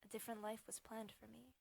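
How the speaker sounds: hushed, sorrowful confession; quiet, resigned sadness; sad whisper. Acted. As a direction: say it softly and sadly, almost whispered. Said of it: "She whispered to him sadly".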